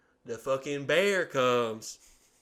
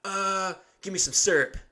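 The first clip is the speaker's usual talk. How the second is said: higher, louder mom voice; mock mom; 7 semitones higher, 5 dB louder than usual. Mom asking son for syrup, higher and louder. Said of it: "she's like".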